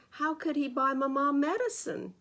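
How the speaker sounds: ordinary delivery, nothing marked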